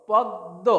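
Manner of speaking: "পদ্ম" split on a held double d, no m; doubled দ in পদ্ম. Shown as a demonstration. Teacher modelling the right form for learners, পদ্ম as "pod-do". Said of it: "দয়ের উচ্চারণটা দ্বিত্ব অর্থাৎ দুবার হবে".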